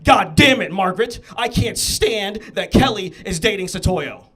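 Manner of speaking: loud, mock-furious father arguing; angry dad. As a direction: rant at the wife as an outraged dad. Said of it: "See a racist dad, like, arguing with his wife".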